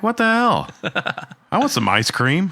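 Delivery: high, indignant mock disbelief; mock outrage. Podcast host retelling finding friends eating ice cream, mock outrage. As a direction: squeak out a jokey what the hell.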